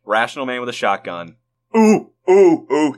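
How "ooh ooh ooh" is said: loud, high excited hooting; excited oohs. Real, not acted. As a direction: hoot with eager excitement. Excited about new idea.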